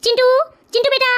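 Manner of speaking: put-on father calls his child from another room; calling out. Father calling son Chintu to dinner, calling out. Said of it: "मैं आवाज लगाता हूँ".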